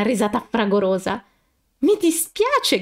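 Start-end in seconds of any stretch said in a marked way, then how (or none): 1.8-2.8 high, slow exclaimed sorry; girl apologizing while fighting a giggle; cried out apology. Acted. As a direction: call out sorry, holding back laughter. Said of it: "gridò Haruka".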